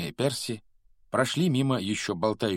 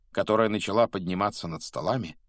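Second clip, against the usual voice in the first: ordinary delivery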